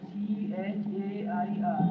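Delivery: letters spelt out slowly one by one; teacher spelling a word for pupils. Shown as a demonstration. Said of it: "spelling out the word".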